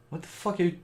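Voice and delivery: rising to a shocked yell; disbelieving what-the-hell; incredulous. Retold or imitated. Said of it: "I'm like".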